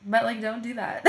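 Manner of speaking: said through real laughter; amused teacher laughing about her student. Real, not acted. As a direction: laugh as you say it. Speaker laughs at the story.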